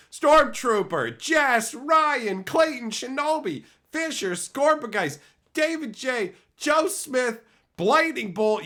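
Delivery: loud, high hype announcer reading names; hype roll call. Real, not acted. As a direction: call each name like a ring announcer. Announcing gifted memberships roll call.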